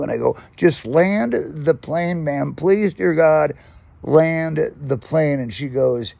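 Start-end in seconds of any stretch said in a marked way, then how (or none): 0.6-5.4 loud, high, drawn-out begging; acted panicked pleading. Terrified passenger begs flight attendant, hammed-up desperate plea. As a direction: beg desperately, stretching the words. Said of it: "I was freaking out".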